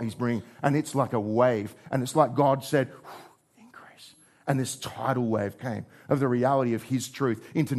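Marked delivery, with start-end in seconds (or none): 3.6-4.1 whisper. Acted. God's whispered word.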